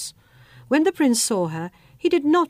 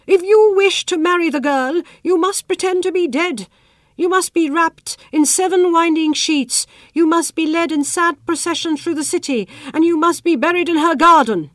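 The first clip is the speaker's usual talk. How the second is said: louder, higher, urgent telling; excited queen; 6 semitones higher, 5 dB louder than usual. Acted. Queen to the prince, loud and eager. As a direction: press every condition eagerly.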